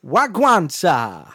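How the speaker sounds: loud put-on Jamaican greeting; Jamaican accent. Visitor trying a silly voice on request, mock Jamaican accent. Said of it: "Or Jamaican?".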